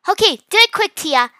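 high, loud hurry-up call; urgent shout. A narrator's reading. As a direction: shout to spur your sister on. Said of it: "Mia shouted".

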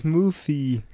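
Smoothie said with a lisped th. Shown as a demonstration. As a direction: Smoothie with the tongue between the teeth. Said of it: "Kannst du mal aussprechen".

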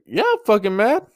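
high-pitched mad retort; retold anger. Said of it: "you can tell I was mad".

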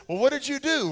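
high, puzzled mock question; mock dad asking. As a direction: ask what the child did. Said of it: "dialogue in acted scene".

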